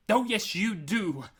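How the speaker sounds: slow, high, loud contradiction; drawn-out, pulsing anger; angry shout. A narrator's reading. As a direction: throw it back at him furiously. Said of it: "Arthur shouted, his anger pulsing".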